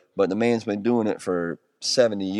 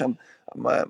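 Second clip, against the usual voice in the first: normal talking voice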